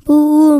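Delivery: «bouh» pleurniché et fort; pleurnichement. Acted. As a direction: pleure comme un petit lapin. Le lapin pleure son doudou. (sanglot joué du lapin)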